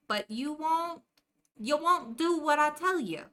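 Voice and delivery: put-on scolding in a New Jersey accent; a hint of Jersey wise guy. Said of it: "You didn't even know there was a Jersey accent".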